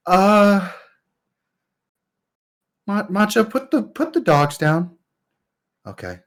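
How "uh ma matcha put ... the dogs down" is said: put-on high nervous kid. Kid telling Matcha to drop wolves.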